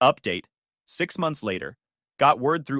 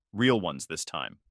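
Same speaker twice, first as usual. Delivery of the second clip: ordinary delivery, nothing marked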